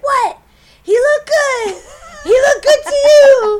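put-on loud, high drunken yelling. Drunk neighbor yelling from her porch, loud and high. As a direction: holler it across the street at strangers. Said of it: "she starts yelling at us".

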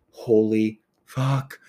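slow, drawn-out swear of disbelief; retold astonishment, very slow; stunned. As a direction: let each word land, as if floored. Stunned reaction to recall retold.

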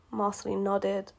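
ordinary delivery

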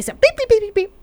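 high, loud imitated computer beeps. Imitating God's control panel with loud high beeps. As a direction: chirp out little button beeps. God pressing creation buttons.

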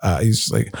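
ordinary talk, nothing marked

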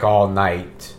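ordinary delivery

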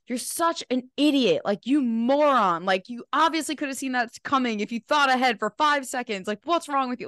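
high, scornful berating of herself; mock her own inner critic tearing into her; harsh inner critic. Mimicking her inner critic scolding her over spilled dressing, high and scornful. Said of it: "I hear myself be like".

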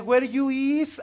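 imitating a clumsy English speaker; bad English. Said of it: "con el peor inglés del mundo". (inglés malísimo imitado)

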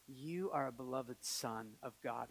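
slow, low, very soft; hushed, tender affirmation; gentle divine assurance. Retold or imitated. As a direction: say it like a quiet inner voice. Inner answer heard in prayer.

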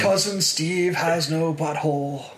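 slow, lightly put-on radio DJ voice; a hint of a laid-back rock DJ, slowed down; Jed the Fish impression. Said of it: "that's my Jed the Fish impression".

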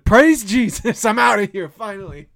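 loud, high cry of relief. Retold or imitated. Retelling relief as boss ends the shift, loud exclamation. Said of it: "I said out loud".

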